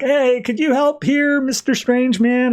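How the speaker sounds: very high strained call; mock plea for help. [name] begging a stranger for help, mock desperate. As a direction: call out high, drawing out the end.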